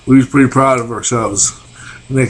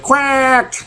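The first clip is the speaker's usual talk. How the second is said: high quacking duck noise; 13 semitones higher than usual. Retold or imitated. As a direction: play dumb and quack like a duck. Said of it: "he went".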